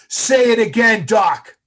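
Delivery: high, booming order to repeat; instructor's loud demand. Retold or imitated. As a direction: shout it out for everyone to hear.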